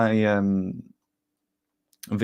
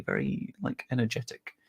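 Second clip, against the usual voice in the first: normal talking voice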